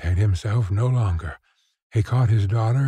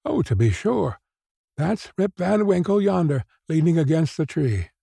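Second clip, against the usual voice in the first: high-pitched villagers pointing someone out; high, certain exclamation; exclaiming; 7 semitones higher than usual. A narrator's reading. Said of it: "exclaimed two or three".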